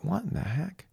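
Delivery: high, hushed mock astonishment; mock bewilderment. Mistress finds the wife in the motel room, mock bewilderment.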